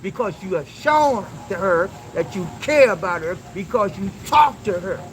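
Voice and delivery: normal talking voice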